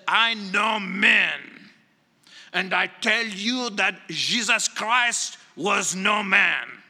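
put-on slow, deliberate French accent, booming on the opening; proud French-accented pronouncement, drawn out. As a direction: quote the emperor in a slow, heavy French accent. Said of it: "I said it with a French accent".

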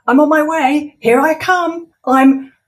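high, loud hurried calling; flustered, hurrying to the door; flustered Ivor. Acted. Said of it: "he called, rushing to the door".

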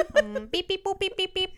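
laughing beep-boop robot noises. Pretend. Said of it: "I'm a robot scientist".

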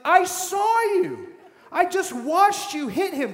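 high, loud, slow scolding; exasperated parent shout. Retold or imitated. Parent scolding a lying child, high slow shout. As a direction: catch the kid red-handed, high and loud. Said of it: "You're like".

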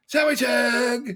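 long, drawn-out high holler; easygoing hippie Jesus. Retold or imitated. Easygoing Jesus walks up to the hurting boy, drawn-out high hippie holler. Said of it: "I have him say".

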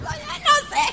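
high voice breaking into laughter; laughing. Real, not acted. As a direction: give up mid-laugh. Laughing at own story. (riendo, con voz aguda)

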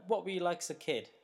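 ordinary talk, nothing marked

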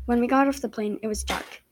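ordinary delivery

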